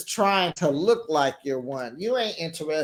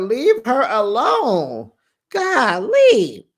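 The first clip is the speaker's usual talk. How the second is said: slow, loud, high "leave her alone", then a burst of "Golly"; fed up, let it rip at the cast; exasperated outburst; 6 semitones higher, 6 dB louder, slower than usual. Real, not acted. Host telling the cast to back off, loud and fed up. Pleading for the divorcing girl.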